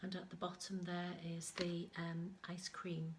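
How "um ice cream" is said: ordinary delivery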